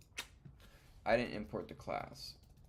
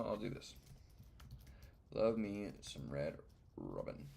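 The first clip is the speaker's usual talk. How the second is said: ordinary talk, nothing marked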